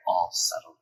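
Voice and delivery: low, soft contented murmur; soft sleepy dog murmur. A narrator's reading. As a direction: let it settle low and hushed. Dog's imagined contented thought.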